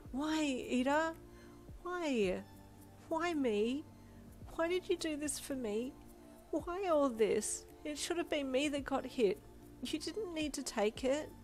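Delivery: tearful outburst. A narrator's reading. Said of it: "tears flooding down your face".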